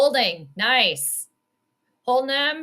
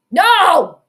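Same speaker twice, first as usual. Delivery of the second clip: higher, louder outraged no; shocked yell; 7 semitones higher, 9 dB louder than usual. Real, not acted. Fan shocked at the fourth-down call, yelling. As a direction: yell in disbelief at the screen.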